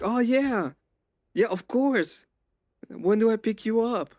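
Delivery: mock eager. Trolling a wrong-number caller.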